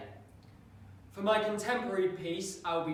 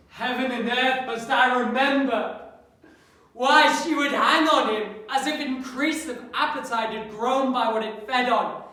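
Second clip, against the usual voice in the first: loud, raised, agonised soliloquy; Hamlet; 8 semitones higher, 13 dB louder than usual. Acted. Hamlet tormented by thoughts of his mother, anguished outburst. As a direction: burst out in anguish at the memory.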